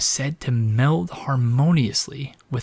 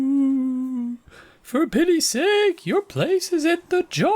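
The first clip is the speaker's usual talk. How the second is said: ghost voice; 14 semitones higher, slower than usual. Acted. Game ghost line played.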